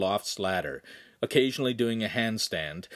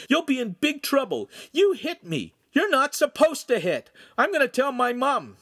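put-on high, indignant boy's tantrum; screamed threat; 5 dB louder than usual. A narrator's reading. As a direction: yell high like a wronged kid. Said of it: "Lincoln screamed".

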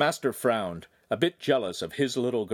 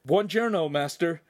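yawned greeting; 7 semitones higher, 3 dB louder than usual. A narrator's reading. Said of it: "Handsome yawned".

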